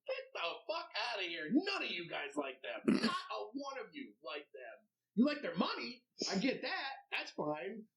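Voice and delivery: high, sneering mock rant at fake fans. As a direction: lift the pitch and scoff at the bandwagon crowd. Mocking townspeople who switch teams.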